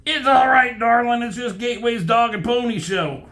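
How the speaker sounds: high, loud, dismissive comic voice; Wolverine. Acted. Reader mocking a hero soothing Elektra, high and dismissive. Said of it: "stupid Wolverine says".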